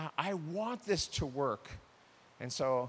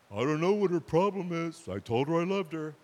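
clueless husband; 6 dB louder than usual. Retold or imitated. Clueless husband complaining about wife.

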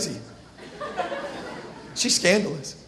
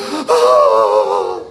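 dramatic gasp; 5 dB louder than usual. Acted. Wife feigns shock.

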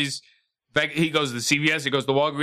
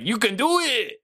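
higher, hammed-up cheer; Rob Schneider impression; 8 semitones higher than usual. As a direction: toss off the silly catchphrase. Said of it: "Just go like".

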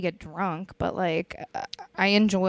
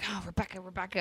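very soft slurred murmur; drunk mumbling; 3 semitones lower, 12 dB quieter, faster than usual. Retold or imitated. Imitating drunk Jess mumbling for Rebecca, very soft. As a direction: mumble the name half-asleep. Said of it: "she just kept mumbling".

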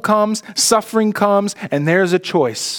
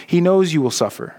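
ordinary talk, nothing marked

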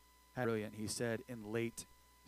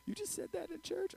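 mock shocked churchgoer; 8 dB quieter than usual. Mock gasp at saying screwed.

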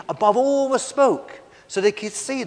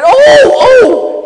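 very high, very loud yelp of pain; scream of pain; 19 dB louder than usual. Acted. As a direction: cry out as if suddenly burnt. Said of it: "He screamed in pain".

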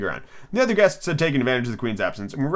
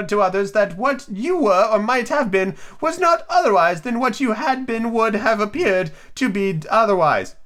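high-pitched storybook Duchess; Duchess character voice; 10 semitones higher than usual. Acted.

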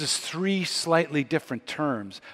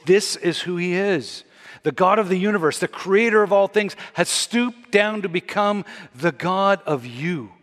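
preaching climax; 4 semitones higher than usual. Real, not acted. Preacher urging men, women and youth, building to a passionate climax. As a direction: rise to the peak of the sermon.

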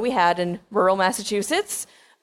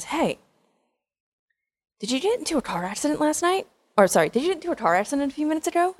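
put-on higher, fast, curious teen girl voice; nosy friend; 8 semitones higher, faster than usual. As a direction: pitch it up like a gossipy classmate. Friend Amanda asking at school.

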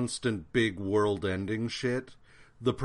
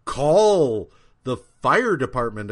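raised, louder mock plea for help; imitated desperate neighbor; 4 semitones higher, 7 dB louder than usual. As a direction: act out a mother calling for help. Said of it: "Missus Simmons is like".

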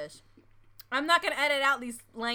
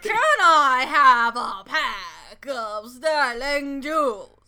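very loud, drawn-out mock British accent; big, slow, over-the-top British impression; exaggerated British accent; 11 dB louder, much slower than usual. Shown as a demonstration. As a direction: belt out a hammed-up British accent, stretching every word. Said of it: "In my accent or your accent? Yours".

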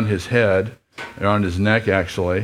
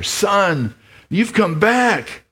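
much higher, slow and wondering; stunned old father mouse; 11 semitones higher, much slower than usual. Acted. Lester greeting his returned son, high and slow. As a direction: breathe it out in disbelief.

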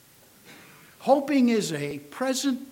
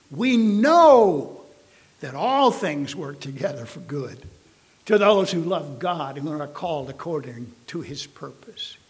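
preaching at full force; 7 dB louder than usual. Acted. Pastor proclaims scripture to the congregation, full-force preaching. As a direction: proclaim it to the back pew.

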